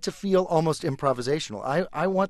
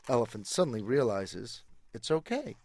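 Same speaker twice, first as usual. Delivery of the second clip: ordinary delivery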